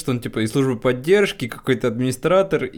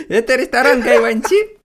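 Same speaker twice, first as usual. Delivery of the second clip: high, loud, laughing cartoon voice; 12 semitones higher, 8 dB louder than usual. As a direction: squeal it out through laughter. Imitating cartoon while laughing.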